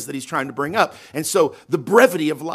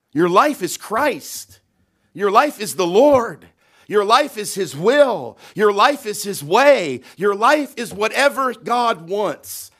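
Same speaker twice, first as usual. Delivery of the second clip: slow, loud, higher preaching refrain; preaching at full force; 6 semitones higher, 6 dB louder, slower than usual. Real, not acted. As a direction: drive each "Your life is" home, slowly. Preaching climax, repeated declarations.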